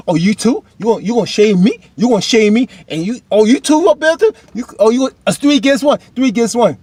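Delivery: loud, high acted outrage; indignant protest. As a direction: protest as if everyone is ganging up on you. Acts Isaiah feeling ganged up on.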